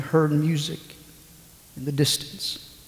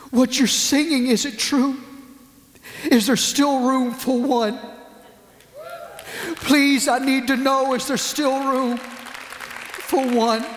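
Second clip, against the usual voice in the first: desperate pleading; 6 semitones higher than usual. Retold or imitated. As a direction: plead like a broken young man asking for hope. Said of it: "through broken sobs".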